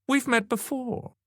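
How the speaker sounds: high, slow exclamation; exclaiming. A narrator's reading. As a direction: draw it out high, sure you recognise them. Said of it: "she exclaimed".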